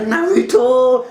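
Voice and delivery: high, loud, slow drawn-out call; calling out a name. Retold or imitated. Fan reenacting Sakura crying out to Naruto, long loud call. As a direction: call across the battlefield to him.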